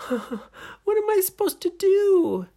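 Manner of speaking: very high, loud wail; panicked heroine. A narrator's reading. Nora panics in the story.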